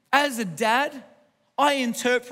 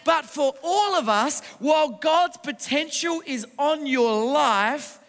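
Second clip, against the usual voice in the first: raised, driving sermon delivery; rousing preaching; 3 semitones higher than usual. Real, not acted. Preacher telling strugglers God gave them no less, rousing preaching. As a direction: preach it out to the whole room with building conviction.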